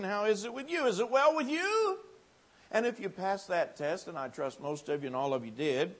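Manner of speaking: normal talking voice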